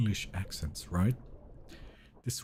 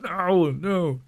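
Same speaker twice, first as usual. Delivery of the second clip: loud, high wail of denial; mock protest; 6 semitones higher, 4 dB louder than usual. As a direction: cry out in playful dismay. Mock protest to teasing girlfriend.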